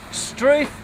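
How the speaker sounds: high, rising struth of mock discovery; mock surprise. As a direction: pretend to be amazed by what's in the drawer. Finds photocopier in drawer.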